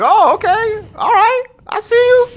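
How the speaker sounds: high put-on voice of mock suspicion; hammed-up suspicious interrogator, high-pitched; suspicious questioning. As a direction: play the doubting officer way up high. Acting out the suspicious Nazi.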